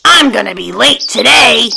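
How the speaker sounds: high, emphatic storybook yelling; drawn-out, exasperated cartoon outburst; angry yell. A narrator's reading. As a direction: yell it out as a grumpy goose. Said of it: "Grumpy Goose yelled angrily".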